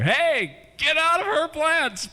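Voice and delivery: high, slow loud shout; yelling at the cat. Retold or imitated. Owner visiting in-laws, yelling at cat, high shout. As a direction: scold the old cat from the door. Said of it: "I'll go over there".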